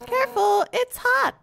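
high, loud, pushy host voice; a brash warrior playing host; character voice. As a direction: boss the guest around, high and loud. Player voices game character.

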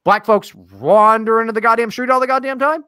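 high, loud incredulous question. Real, not acted. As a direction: push it up high in comic disbelief. Incredulous comic counter-suggestion to article.

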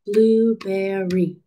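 "Blueberry" slow, in three even beats; syllable by syllable. Shown as a demonstration. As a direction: chant "Blueberry" one syllable per clap. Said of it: "Three syllables".

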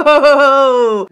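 loud, high, long laughing whoa; amazed whoa. Real, not acted. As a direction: let out a big drawn-out whoa. Reacting to a badly scratched pan.

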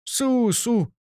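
jolly him along like a big friendly host; a burst of rough cheer; hearty good humour. A narrator's reading. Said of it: "con la sua barbarica bonomia".

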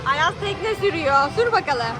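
normal talking voice

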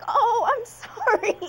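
slow, laughing "sorry"; laughing apology. Retold or imitated. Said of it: "I was like".

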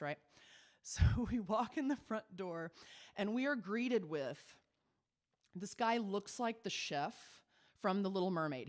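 ordinary talk

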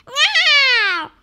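high, drawn-out startled meow; high yowl sliding downward; cat's cry. A narrator's reading. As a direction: cry out like a frightened cat. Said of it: "cried Wilbur".

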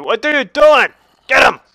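loud, high-pitched scolding command; exasperated yell. Real, not acted. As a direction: yell orders at a useless pet. Scolds his wolf Bruce.

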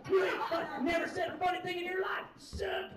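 high, yelled crowd jeers; mock heckling. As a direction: imitate a hostile drunk crowd hurling insults. Imitates hostile heckling crowd.

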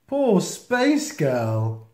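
plain reading voice

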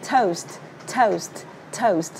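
"toast" with the st popped out; crisp st ending on each "toast"; released final st. Shown as a demonstration. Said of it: "nó sẽ là st bật ra nhé".

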